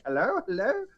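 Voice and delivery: high-pitched questioning hello; mock phone greeting, lifted high; woman answering phone. Chase answering a ringing phone, high questioning voice.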